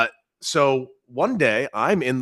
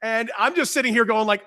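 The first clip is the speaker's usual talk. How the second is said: normal talking voice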